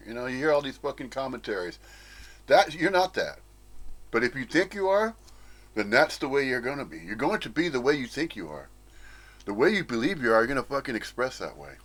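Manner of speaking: usual speaking voice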